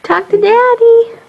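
put-on high, slow coaxing baby talk. Mom coaxing the baby to talk to Daddy, high sing-song baby talk.

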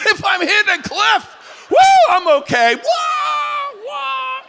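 loud, high, whooping mock of the snowboarder; mock thrill. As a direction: ham up the rush of dropping off a cliff. Mocking the snowboarder's cliff jumps.

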